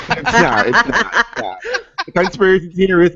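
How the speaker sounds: normal talking voice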